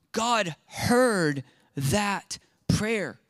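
very slow, voice breaking with real emotion; near tears. Real, not acted. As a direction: say it as if it just overwhelmed you. Moved preacher near tears.

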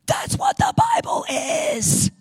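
high, loud, impassioned sermon; fervent preaching. Acted. Preacher urging his flock to read the Bible, fervent and loud. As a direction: preach it out like a revelation. Preaching at full force, rising passion.

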